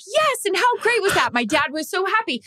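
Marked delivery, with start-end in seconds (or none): 0.1-1.3 excited gush. Retold or imitated. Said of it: "I'm like".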